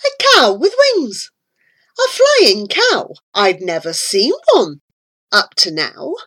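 usual speaking voice

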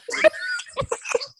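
high shrieking burst of laughter. Real, not acted. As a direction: let out a squealing laugh. Shocked laugh at cartoon title.

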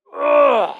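sudden loud frustrated grunt; angry grunt. Retold or imitated. Preacher voicing the natural man's urge to fight, loud grunt. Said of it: "the natural man inside of us is like".

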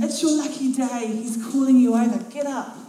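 excited call. A narrator's reading. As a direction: crowd telling the beggar good news. Crowd calls the beggar over.

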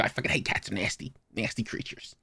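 hushed, breathy-whispered disgust; sister's disgust. Retold or imitated. Owner mimics sister hating cats, hushed disgusted voice. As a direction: whisper it with real distaste. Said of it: "She's like".

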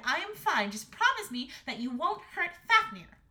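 put-on high, loud pleading girl; insistent Astrid. A narrator's reading. Daughter asking mother to spare the dragon, insistent. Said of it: "said Astrid".

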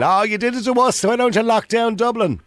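ordinary talk

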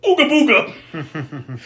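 slow, silly monster boo; mock spooky boo. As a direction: draw it out like a goofy scare. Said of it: "until he goes".

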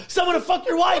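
very high, loud delivery; gleeful punchline. Retold or imitated. Friend finishing a knock-knock joke, gleefully loud. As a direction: shout the punchline in delight.

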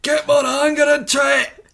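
high-pitched shouted Scottish outburst; angry Scottish accent. Acted. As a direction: yell it as a mock-furious Scot. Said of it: "just anger in general".

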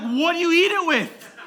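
high, loud shouted question; mock exasperated yell. Dad questioning picky daughter, mock exasperated yell. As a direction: yell it as the baffled parent.

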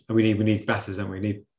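ordinary talk, nothing marked

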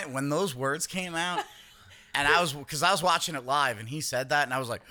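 ordinary talk

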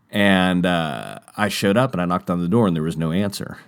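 ordinary talk, nothing marked

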